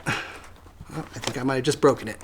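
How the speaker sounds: ordinary talk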